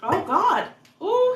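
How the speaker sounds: ordinary talk, nothing marked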